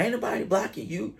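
lightly voiced angry mom; mock angry mother. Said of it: "the lady is like".

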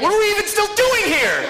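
high, laughing, delighted outburst; so excited it breaks into laughter; laughing excitement. Real, not acted. Excited about the D&D idea.

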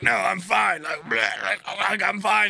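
high, loud mock protest broken by gagging; talking while retching. Sick passenger insists she is okay, retching between words. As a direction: imitate insisting through vomit. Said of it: "the lady's throwing up".

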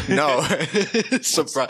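words broken up by laughter; laughing. Real, not acted. Co-host teases about homemade drink, laughing reply.

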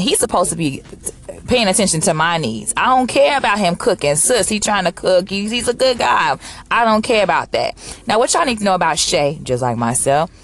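ordinary talk

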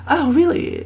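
high, arch mock-interviewer voice. A celebrity interviewer catching her out, arch mock surprise. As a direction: play the sly interviewer springing a trap. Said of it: "they can be like".

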